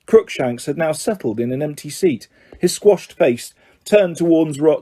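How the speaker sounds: ordinary delivery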